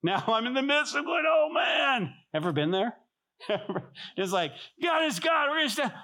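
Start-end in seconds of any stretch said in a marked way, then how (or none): 0.3-2.1 high, strained cry of panic; mock freak-out. Pastor recalling his doubts after bold words, mock freak-out. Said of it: "now I'm kind of freaking out". 4.8-5.9 very high, strained mock shout; hammed-up strained declaration. Pastor acting out faith while freaking out, strained mock shout.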